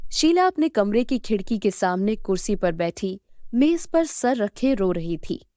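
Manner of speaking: ordinary delivery, nothing marked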